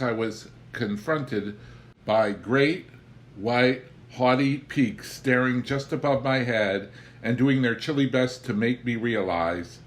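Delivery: usual speaking voice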